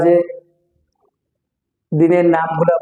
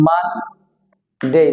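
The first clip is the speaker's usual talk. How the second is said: Monday slowly in two parts, "maann ... dey"; pressed, stretched syllable; much slower than usual. Shown as a demonstration. Teacher showing its two syllables, pressed stretched syllable. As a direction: lean on the n of Monday, then pause. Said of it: "চাপ দিয়ে বলতে হবে এটা".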